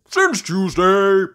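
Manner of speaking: slow, loud, high answer; Grampy Rabbit. Acted. Grampy Rabbit answers Peppa, loud drawn-out voice. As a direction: draw it out loudly. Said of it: "says Grampy Rabbit".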